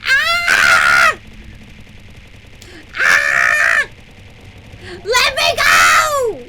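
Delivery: loud high pleading shouts, slow; hammed-up captive. Streamer playing a trapped prisoner shouting to be freed. Acting a captured character.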